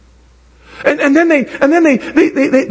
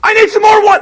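hollering for the waiter; calling out to waiter; 13 semitones higher than usual. Retold or imitated. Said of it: "I didn't go".

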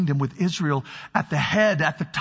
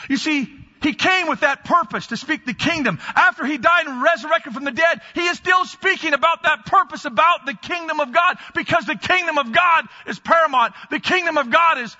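loud, high, driving preacher voice; preaching; 8 semitones higher, 4 dB louder than usual. Real, not acted. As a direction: preach it out with full force. Preaching at full force.

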